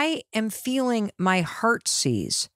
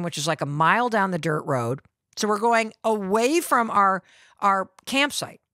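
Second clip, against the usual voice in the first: ordinary talk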